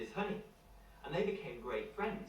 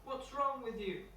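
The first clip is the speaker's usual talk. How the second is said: put-on a much higher, childlike voice, puzzled; little boy Tom; 10 semitones higher than usual. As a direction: pitch up high to play the small boy. Tom answers the bear.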